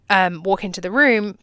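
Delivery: ordinary talk, nothing marked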